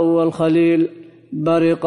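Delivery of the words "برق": i vowel on the middle letter of «برق»; kasra on the ra. Shown as a demonstration. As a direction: say «برق» as bariqa, with a short i after ر. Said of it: "بالكسر". («برق» بكسر الراء: بَرِقَ)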